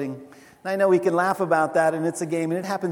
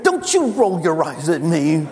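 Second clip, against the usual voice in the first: louder, put-on stern parent voice; scolding parent; 3 semitones lower, 5 dB louder than usual. Parent telling off an eye-rolling kid, mock scolding. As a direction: lay down the law, mock outrage.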